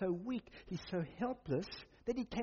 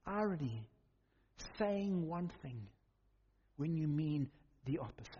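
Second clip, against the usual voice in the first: ordinary talk, nothing marked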